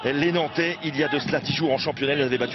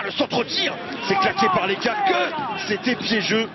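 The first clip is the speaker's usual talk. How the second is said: high sudden save call; excited sports commentary; 12 semitones higher, slower than usual. Acted. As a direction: snap out the save.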